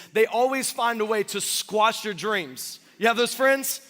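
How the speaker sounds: normal talking voice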